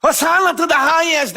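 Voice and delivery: high, loud joyful cry; crowd shouting. Retold or imitated. Pastor reenacts the Palm Sunday crowd, loud joyful shout. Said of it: "they were all shouting".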